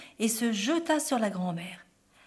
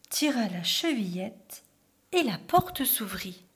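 ordinary delivery, nothing marked

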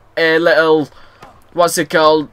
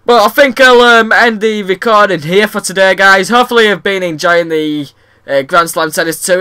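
ordinary delivery

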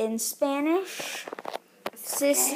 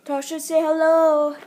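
calling out; 4 semitones higher, 5 dB louder than usual. Real, not acted. Calling to sister.

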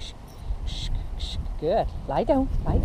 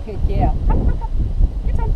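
ordinary talk

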